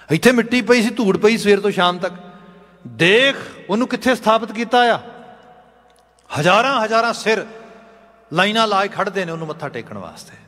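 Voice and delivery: high-pitched emphatic sermon delivery; impassioned exposition of scripture; fervent preaching. Real, not acted.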